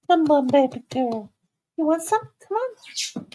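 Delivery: high cooing call to the puppy; coaxing the dog. Real, not acted. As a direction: coax her up in a high sweet voice.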